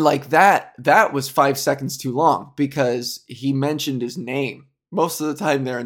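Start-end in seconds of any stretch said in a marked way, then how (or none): none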